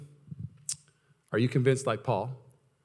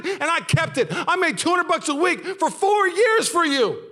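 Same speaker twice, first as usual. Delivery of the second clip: much higher and louder, raging complaint at God; retold shout at God; 13 semitones higher, 5 dB louder than usual. Pastor reliving yelling at God in his car, much higher and louder. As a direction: yell your grievances up at God, voice high and loud. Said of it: "I was crying my eyes out, screaming at him".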